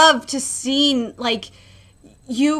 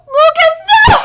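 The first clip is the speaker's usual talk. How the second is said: sudden high, loud cry of "no" at a shocking panel; anguished shout; 8 dB louder than usual. Real, not acted. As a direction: yell it out in real anguish. Reader hits shocking manga panel.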